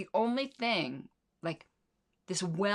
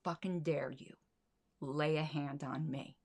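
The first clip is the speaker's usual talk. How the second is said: much softer, slow, fury held under the breath; seething quietly; 3 semitones lower, 7 dB quieter than usual. Retold or imitated. Woman recalling her inner rage at the kid, quiet seething. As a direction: barely voice the rage, slowly. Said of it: "all I could think about was".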